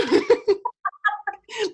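a high, bright laugh. Real, not acted. After refusing the hug, a high laugh.

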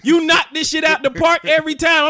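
loud laughing yell; mock angry yelling. Real, not acted. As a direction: shout it while laughing. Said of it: "I'm getting angry at you".

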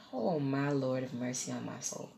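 low, soft sighing prayer; subdued dismay. Retold or imitated. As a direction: murmur it in drained disbelief. Acts out weary reaction.

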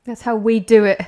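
loud, punchy catchphrase; shouted catchphrase. Pretend. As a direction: belt it out like a hype line. Echoing [name] catchphrase, shouted.

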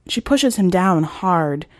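ordinary delivery, nothing marked

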